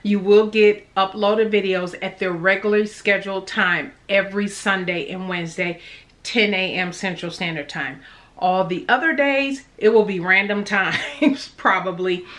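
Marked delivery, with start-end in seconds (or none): none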